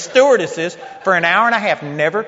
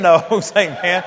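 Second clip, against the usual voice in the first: ordinary delivery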